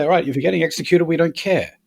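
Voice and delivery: dismissive quote. Pretend. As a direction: toss it off like nobody cares. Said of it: "we say".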